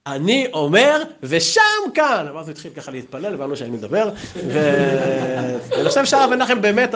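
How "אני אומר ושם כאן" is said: ושם כאן drawn out high; ושם כאן as a high, slow pronouncement; emphatic final answer. Retold or imitated. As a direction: stretch ושם כאן slowly, pitched up. Retold final insistent answer.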